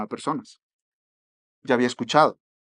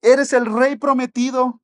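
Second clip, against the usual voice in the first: high, slow, drawn-out proclamation; proclaiming shout; 9 semitones higher, 4 dB louder, slower than usual. Retold or imitated. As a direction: cry it out to the passing king. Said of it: "y gritaba".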